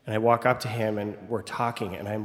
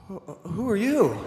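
higher, puzzled question; mock bewildered dad; 8 semitones higher than usual. Dad asks the stranger who he is, higher and stunned. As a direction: play the dad's disbelief. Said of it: "he says".

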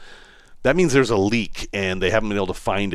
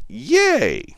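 excited cheer; 5 dB louder than usual. Real, not acted. Cheer at owning the camper.

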